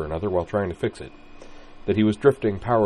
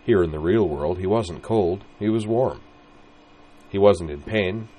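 ordinary delivery, nothing marked

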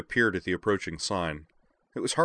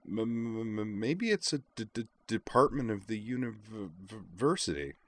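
halting stammer, pitched up; tentative, higher stammering voice; stuttered musing; 4 semitones higher, 4 dB quieter than usual. A narrator's reading. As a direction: stutter on the first sounds while musing. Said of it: "Caitlin mused".

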